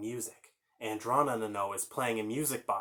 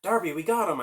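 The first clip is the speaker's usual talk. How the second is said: high, loud victory call; triumphant cheer; 6 semitones higher, 7 dB louder than usual. Acted. Brother cheering to Darby after beating villain. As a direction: celebrate the win. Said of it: "I said".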